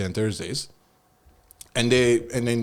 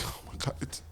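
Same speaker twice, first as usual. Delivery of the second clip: breathy aside; 15 dB quieter than usual. Retold or imitated. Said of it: "I'm like".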